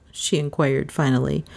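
ordinary delivery

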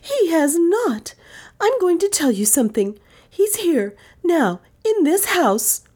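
put-on high, indignant young woman; fiery. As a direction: burst out in fierce defence. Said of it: "she continued fierily".

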